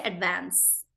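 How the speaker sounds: "advance" with a flat American A as in "van". Shown as a demonstration. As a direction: say "advance" the American way, vowel as in "cat". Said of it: "In British".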